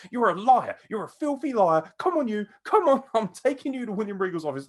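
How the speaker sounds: loud, high-pitched accusing rant; pissed off. Retold or imitated. Wrestler accusing his interviewer of lying, pissed off. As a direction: imitate an outraged man hauling someone off. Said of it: "how fucking pissed off he was".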